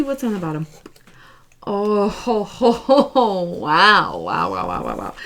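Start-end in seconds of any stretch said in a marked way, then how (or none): 1.6-3.4 loud rising ho ho ho; delighted laugh at the card; happy hooting chuckle; gleeful chuckle. Real, not acted. Reacting to revealed tarot card. 3.4-5.1 each wow sinking much lower and fading; amazed, then hushed; awed wow. Real, not acted. Stunned by the card spread.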